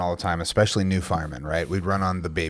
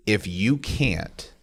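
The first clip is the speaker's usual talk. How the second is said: drawn-out, weighty warning; slow it right down to make it land; slow serious warning; slow, deliberate; much slower than usual. Retold or imitated. Stern advice to firemen.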